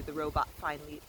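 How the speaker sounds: ordinary delivery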